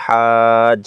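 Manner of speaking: drawn-out recitation of হাজ; long madd. Shown as a demonstration. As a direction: stretch হাজ for four counts. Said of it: "উপরে মোটা চিহ্ন".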